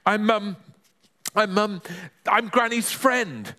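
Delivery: high, hesitant stammer; flustered boy groping for an excuse; spluttering. Acted. Panicking boy lies to neighbour, high splutter. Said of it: "spluttered Ben".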